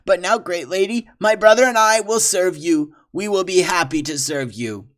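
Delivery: obsequious French trapper; fawning trapper. Acted. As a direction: grovel and flatter. Villain fawning.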